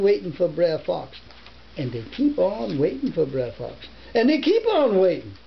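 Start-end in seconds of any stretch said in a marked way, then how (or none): none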